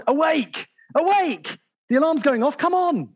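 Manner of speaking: high, insistent wake-up cries; high impatient "come on"; urgent plea. Pretend. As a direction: rouse God like an alarm. People urging God to act.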